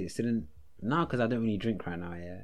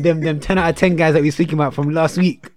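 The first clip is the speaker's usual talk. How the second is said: loud teasing; 6 semitones higher, 13 dB louder than usual. Real, not acted. Teasing friend loudly.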